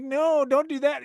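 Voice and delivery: high put-on girl voice; girls refusing. Girls push away the nerdy guy, high and prissy. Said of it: "And they're like".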